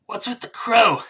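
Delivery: high-pitched, cheeky squirrel; squirrel character. Acted. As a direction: demand an answer in a high squirrel voice. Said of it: "he looks at you and he goes".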